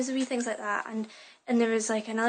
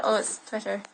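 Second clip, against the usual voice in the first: ordinary talk, nothing marked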